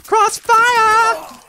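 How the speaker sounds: high hyped shout; excited shout. Real, not acted. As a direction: call out the medal loud. Shouting out a medal.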